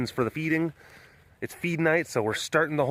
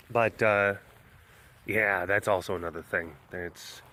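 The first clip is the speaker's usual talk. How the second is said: ordinary talk, nothing marked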